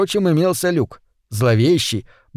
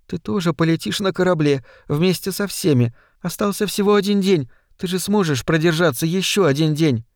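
put-on higher, lighter young woman's voice; tender coaxing in a raised pitch; pleading granddaughter; 5 semitones higher than usual. A narrator's reading. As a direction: plead gently with someone to hold on.

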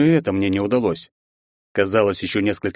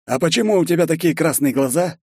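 worried friend asking; 8 semitones higher than usual. A narrator's reading. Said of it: "спросил Вогнер".

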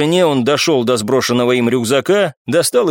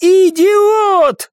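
very high, loud outburst; enraged high-pitched yell; furious scream; 5 dB louder than usual. A narrator's reading. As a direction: scream the insult in rage. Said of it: "прокричала баронесса с яростью".

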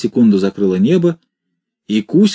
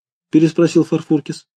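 plain reading voice

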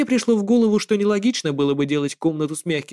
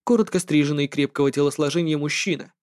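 usual speaking voice

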